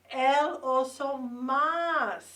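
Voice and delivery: El Oso Más drawn out slowly and loud for repeating; slow teacher model. Shown as a demonstration. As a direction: stretch out El Oso Más syllable by syllable. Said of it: "Say the word más".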